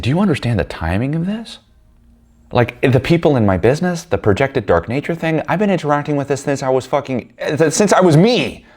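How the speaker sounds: high-pitched exasperated protest; retold outburst, pitch climbing to the last words. Confronting her about the timing, exasperated.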